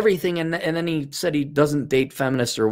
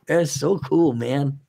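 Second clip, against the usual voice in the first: hushed, softer awed fan gushing; starstruck fan; 3 semitones higher, 5 dB quieter, slower than usual. Retold or imitated. Imagined fan meeting Kurt Russell, softly awed gushing. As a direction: gush softly like a dazzled fan. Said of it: "you know, but just like".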